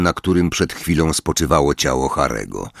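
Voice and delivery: ordinary delivery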